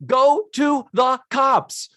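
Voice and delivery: slow, high, word-by-word comic exasperation; exasperated. Acted. Telling film characters the obvious step, exasperated. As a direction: spell out the obvious, drawn out and high.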